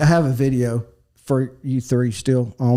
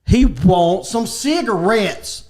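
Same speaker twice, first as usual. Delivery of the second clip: slow, loud, raised trucker voice; imitated trucker; 6 semitones higher, 5 dB louder, much slower than usual. Trucker translating for the store lady, imitated trucker voice. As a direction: spell it out slowly like a translating trucker. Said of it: "And this trucker said".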